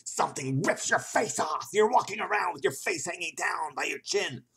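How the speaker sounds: high, loud, hammed-up outburst; mock-horror yell. Player spoofing the spooky story, mock-horror yell. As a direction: yell it like over-the-top horror.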